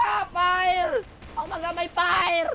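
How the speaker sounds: high, stretched pretend alarm; mock panic. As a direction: cry out about danger in play panic. Pretend panic at fire in game. (kunwaring natatarantang sigaw)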